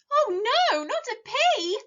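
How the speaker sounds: high, loud horrified gasp; gasping in alarm. A narrator's reading. Vegetables hearing the pea is loose, high loud alarm. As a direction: cry it out in shock, high and loud. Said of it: "everybody gasped".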